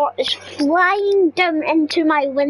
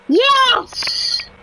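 much higher victory cry; triumphant yes; 10 semitones higher than usual. Real, not acted. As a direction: celebrate the win. Finally beats the challenge.

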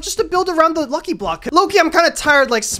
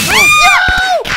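high, loud victory yell; big squeal of joy; excited cheer; 7 dB louder than usual. Real, not acted. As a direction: celebrate the win. Lucky block win.

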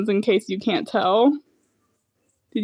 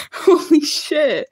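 shaken laugh; 6 semitones higher, slower than usual. Real, not acted. Laughing after fright.

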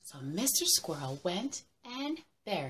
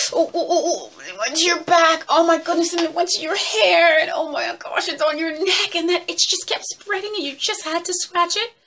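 higher, hurried, mounting itch panic; rushed, high-pitched ooh-it-itches fuss; frantic itchy excitement; 11 semitones higher, 11 dB louder than usual. Acted. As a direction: squirm through it as the itch spreads. Acting out a spreading itch.

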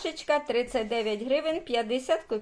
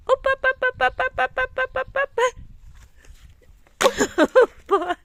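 high rhythmic cheering; cheering steps; 12 semitones higher, 5 dB louder, faster than usual. Real, not acted. Mother cheering each step of her toddler, high. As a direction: bounce each syllable with the steps.

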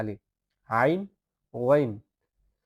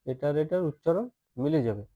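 usual speaking voice

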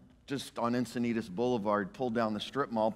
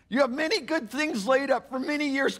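high, loud self-satisfied gloating; smug rich fool; 11 semitones higher, 4 dB louder than usual. Acted. Pastor voicing the rich man gloating to his soul. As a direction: preen over the full barns.

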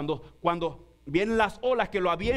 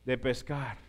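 mock worried lament; 6 semitones lower than usual. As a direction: moan it like a broke fisherman. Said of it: "Pedro pensando y diciendo".

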